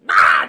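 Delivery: very loud, low "ah"; loud stomping yell. Retold or imitated. Benny runs up and stomps on the glasses, big mock yell. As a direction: bellow out a short, rough yell.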